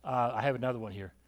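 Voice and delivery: normal talking voice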